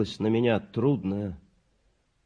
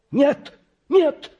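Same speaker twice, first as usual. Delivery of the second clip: mother's shriek; 8 dB louder than usual. A narrator's reading. Mother refuses again.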